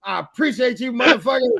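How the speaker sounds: hammed-up grateful yell; excited retold thanks. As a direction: shout gratitude like a buddy. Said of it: "And I was like".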